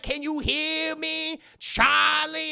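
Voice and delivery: slow, high, loud calling-out; exasperated imitation yell; mock yelling. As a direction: stretch it out like scolding someone who won't listen. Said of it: "if they just yelled at him".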